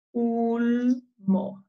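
"Ulmo" with held "ul", then "mo"; sounding out. Shown as a demonstration. Teacher leading reading all together, "Ulmo" syllable by syllable. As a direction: split "Ulmo" into long syllables. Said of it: "Leamos todos juntos".

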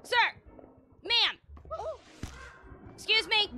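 loud, high pestering shouts; calling out. Pretend. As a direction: keep hollering for their attention in play. Pestering game characters for attention.

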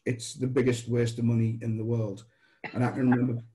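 normal talking voice

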